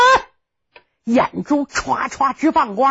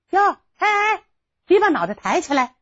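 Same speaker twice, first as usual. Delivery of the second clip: 丫鬟的高声; 8 semitones higher than usual. Acted. 丫鬟叫犯人抬头.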